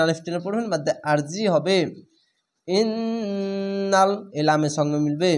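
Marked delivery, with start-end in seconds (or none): 2.7-4.1 ইন্নাল very slow, the ন্ন held through the nose; nasal ghunnah. Shown as a demonstration. Teacher demonstrating compulsory ghunnah on ইন্নাল. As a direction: hold the ন in ইন্নাল as a long hum. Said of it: "ওয়াজিব গুন্না".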